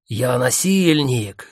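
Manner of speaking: slow growling cartoon villain. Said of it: "зарычал Фома голосом злодея из мультика".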